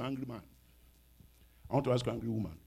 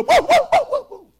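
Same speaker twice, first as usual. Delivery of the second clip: loud, high imitation bark; mad dog barking; 16 dB louder than usual. Preacher mimics a mad dog for the congregation, loud high barking. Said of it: "If a dog is mad".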